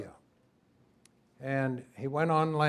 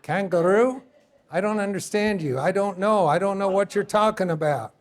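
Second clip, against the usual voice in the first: higher and louder, demonstrating puzzled confusion; acted bafflement; 5 semitones higher, 6 dB louder than usual. Shown as a demonstration. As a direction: play the lost listener, raised and bewildered. Acting out not understanding.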